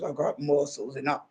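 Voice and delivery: low, dopey muscle-man voice; lightly voiced gym bro; mocking a bragging man. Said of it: "all they're talking about is".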